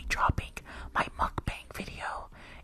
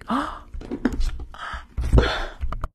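excited gasp; 11 dB louder than usual. Real, not acted. Reacts to a gift.

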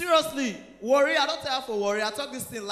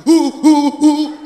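stammering; 15 dB louder, much slower than usual. Retold or imitated. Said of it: "say stammerer".

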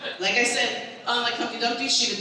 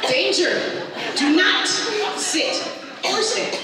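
high, loud and very slow; dramatic warning; 9 semitones higher, 4 dB louder, much slower than usual. Pretend. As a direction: announce it like a grave warning label. Reading the ladder warning aloud.

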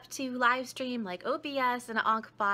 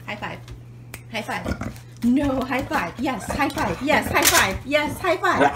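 dog command; 12 dB louder than usual. Real, not acted. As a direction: coax the dog loudly. Commanding dog to high five.

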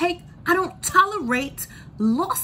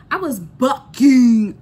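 slow, belted out, much louder; shouting how much she resisted; 13 dB louder, slower than usual. Real, not acted. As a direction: belt it, drawn out. Emphatic outburst about resisting.

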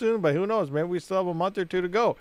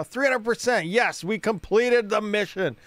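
excited; 7 semitones higher, 4 dB louder, slower than usual. Real, not acted. Unlocking max overclock.

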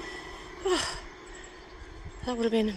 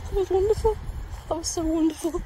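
higher, gushing with delight; overjoyed; 9 semitones higher than usual. Real, not acted. Just watched the steam train pass, thrilled to her friend. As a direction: pour out pure joy.

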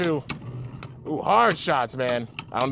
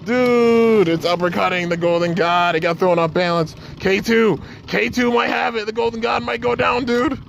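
excited shouting; 9 semitones higher than usual. Real, not acted. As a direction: yell it out as the underdog wins. Climax of a close battle.

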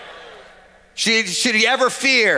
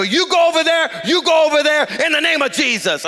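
raised, high-pitched commanding voice; acted forceful orders; 7 semitones higher than usual. Pastor separates fighting gangs, forceful raised voice. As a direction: send each side away with authority.

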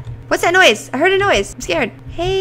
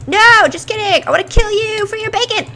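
mock menace; 7 semitones higher, 6 dB louder than usual. Turning menacing.

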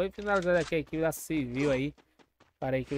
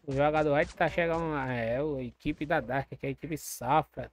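ordinary talk

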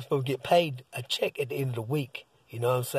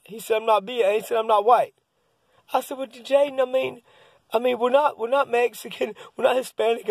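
much higher, louder, excited retelling; flustered back-and-forth; 8 semitones higher, 4 dB louder than usual. Retold or imitated. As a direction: rattle it off high and worked up. Son refuses, dad scrambles to answer.

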